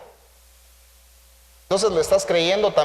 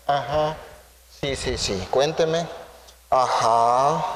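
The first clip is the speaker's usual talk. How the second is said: bored psychologist; 5 semitones lower, much slower than usual. Retold or imitated. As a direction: murmur like a bored therapist half listening. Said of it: "El psicólogo se pone en estas".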